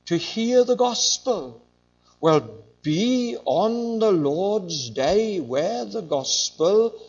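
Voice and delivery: loud, slow, rising preacher's cry; preaching at full force. Real, not acted. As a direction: build the call to hear the gospel. Preaching climax.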